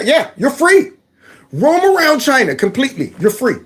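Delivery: high-pitched, hammed-up generous official; mock permission. Imagined Chinese official letting him roam, high mock generosity. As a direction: grant total freedom with sarcastic glee.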